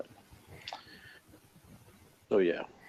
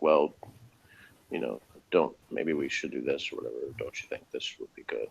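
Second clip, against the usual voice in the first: a light imitation of a meek girl, softer and lower; hesitant little suggestions that fade away; timid Zelda; 7 semitones lower, 8 dB quieter than usual. Imitating a timid girl offering ideas to the others, meek and soft. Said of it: "very good impression of".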